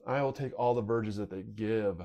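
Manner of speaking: usual speaking voice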